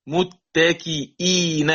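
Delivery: متكئين slowly, the hamza after kasra clear; slow tajweed demo. Shown as a demonstration. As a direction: model متكئين to students. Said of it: "مثال". (متكئين بتأنٍّ)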